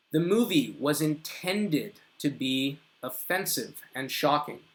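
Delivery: slow, deliberate model sentence; slow example sentence. Shown as a demonstration. Teacher giving students an example, slow and deliberate. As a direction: read it out slowly for learners. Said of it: "So again, we can also".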